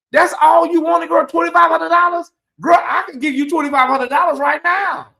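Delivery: high-pitched incredulous shriek, laughing it off. Real, not acted. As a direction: squeal in disbelief at such a small sum. Host scoffs at small demand.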